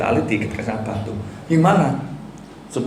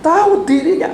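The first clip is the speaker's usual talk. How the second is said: much higher, much louder exclamation; emphatic burst; 6 dB louder than usual. Acted. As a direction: fling the words out with sudden force. (seruan mendadak yang jauh lebih tinggi dan keras)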